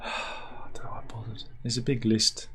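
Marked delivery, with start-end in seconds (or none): 0.0-1.4 deadpan whispered aside. Real, not acted. Host whispering a defeated aside. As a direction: sigh it out in a whisper.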